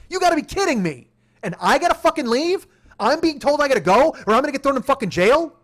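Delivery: high, loud disbelief; incredulous outrage. Retold or imitated. Driver protesting being threatened with jail, incredulous. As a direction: throw the threat back in outrage.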